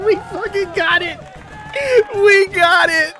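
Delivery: laughing then crying with joy; overwhelmed happy tears; tearful joy. Real, not acted. Crying with joy after winning.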